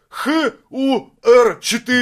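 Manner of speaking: Х У Р четыре, each letter slow and high; spelled out slowly. Retold or imitated. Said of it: "из серии".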